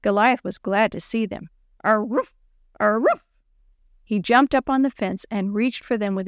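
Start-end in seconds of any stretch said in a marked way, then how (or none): 1.8-2.3 voiced cartoon-like "arroof" bark; dog bark. Goliath glad to see the girls, eager dog bark. 2.8-3.2 voiced cartoon-like "arroof" bark; dog bark. Goliath glad to see the girls, eager dog bark.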